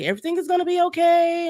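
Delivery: high, quick mock reassurance; sing-song reassurance. Patient imitating staff before surgery, high-pitched reassurance.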